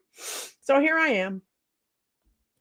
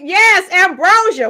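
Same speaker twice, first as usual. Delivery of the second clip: loud, high cheer; excited shout; 5 semitones higher, 8 dB louder than usual. Real, not acted. Livestreamer cheering for [name] mid-song, excited shout. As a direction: shout it like a thrilled fan.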